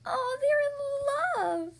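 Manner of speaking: high, slow swoon. Acted. Pig swoons over lovebirds.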